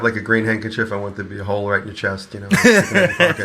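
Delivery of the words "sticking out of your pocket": laughing. Real, not acted. Joking, laughing about green screen.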